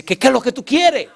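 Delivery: high, insistent mock demand; retold demand. Woman presses the man in line, impatient high demand. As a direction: imitate her impatience, pitch jumping up.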